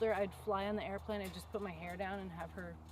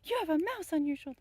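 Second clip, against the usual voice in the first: imitating the kid; 10 semitones higher, 9 dB louder than usual. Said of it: "he was like".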